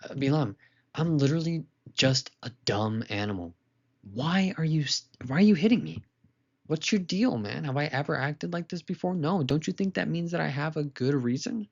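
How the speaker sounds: a dumb animal pleading, why are you hitting me; talking donkey. Acted. Donkey rebukes Bilam for the beating, in a high pleading voice. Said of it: "The donkey basically rebuked Bilam".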